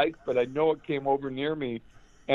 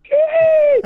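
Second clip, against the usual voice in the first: put-on high, loud squeal like an excited little girl; little-girl fangirl scream; 10 dB louder than usual. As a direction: shriek it like a starstruck fangirl. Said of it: "like a little girl".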